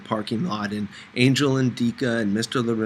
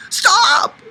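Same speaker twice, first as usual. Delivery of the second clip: very high, loud panicked scream; shrill cry; 11 dB louder than usual. A narrator's reading. Narrator screams at Fanny spraying bug repellent. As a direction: yell to stop her spraying. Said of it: "My voice sounded shrill".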